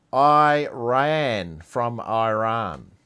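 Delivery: very slow "I ran from Iran", I stressed; slow, deliberate pun; slow punchline. Real, not acted. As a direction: deliver the pun slowly, word by word. Punchline after repeated Iran.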